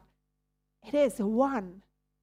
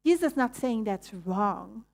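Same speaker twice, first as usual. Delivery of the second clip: ordinary talk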